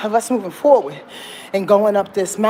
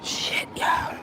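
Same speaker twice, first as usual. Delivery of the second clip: whispering; 7 dB quieter than usual. Real, not acted. Whispered curse.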